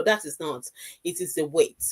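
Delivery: ordinary talk, nothing marked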